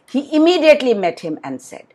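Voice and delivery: plain reading voice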